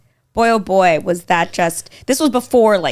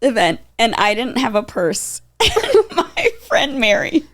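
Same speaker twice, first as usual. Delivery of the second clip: ordinary delivery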